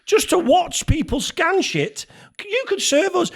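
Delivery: high, drawn-out incredulous questioning; retold indignation. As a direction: challenge the idle shop worker in disbelief. Retold outrage at idle shop worker.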